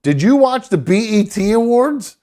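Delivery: a hint of a nagging mother; mom asking. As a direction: play his mother checking up on him. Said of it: "she goes".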